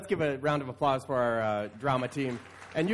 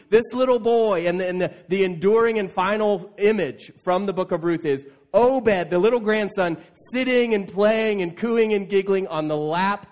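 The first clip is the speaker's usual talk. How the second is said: louder, fervent sermon voice; preaching; 3 semitones higher, 5 dB louder than usual. Acted. Pastor preaching to the church, loud and fervent. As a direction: drive it home to the congregation with rising fervor.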